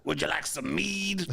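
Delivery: slow, lightly voiced tavern keeper; Renaissance tavern voice. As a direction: offer the mead like an old barmaid. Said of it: "what's a good Renaissance voice".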